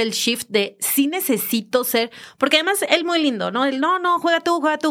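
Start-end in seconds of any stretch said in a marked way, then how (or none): 3.8-4.9 higher-pitched imitation of polite insisting; quick, high, acted insistence; insistent kind offer. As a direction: lightly mimic someone giving up their turn. Acts out him kindly offering turn.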